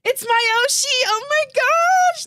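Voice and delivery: gushing, near tears of joy; overwhelmed squeal. Real, not acted. Overwhelmed by being called Oshi.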